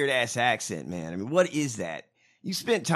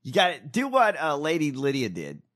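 normal talking voice

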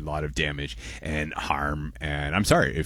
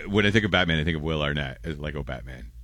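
ordinary delivery

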